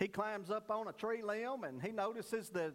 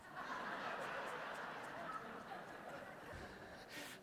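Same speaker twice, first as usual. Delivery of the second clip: crowd laughter; 9 dB quieter than usual. Real, not acted. Audience laughs at punchline.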